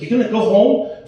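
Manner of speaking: ordinary talk, nothing marked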